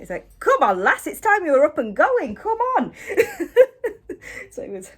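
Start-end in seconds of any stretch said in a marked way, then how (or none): 0.4-2.9 imitating a Lancashire engineer, loud and higher; hammed-up Lancashire "come on, lass"; Lancashire accent. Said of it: "had a Lancashire accent". 3.0-4.4 high laugh. Real, not acted. Laughing after the impression.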